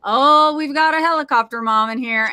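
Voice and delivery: a nurse's loud, high, mocking "Oh", then teasing. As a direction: imitate a nurse poking fun at an overprotective mother, loud. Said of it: "she goes".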